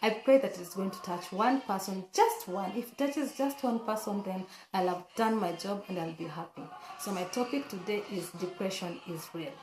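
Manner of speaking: ordinary talk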